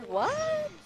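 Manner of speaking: very high-pitched "what"; baffled surprise. Real, not acted. Streamer puzzling over the name Streetward Rambler, baffled. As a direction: squeak it in disbelief.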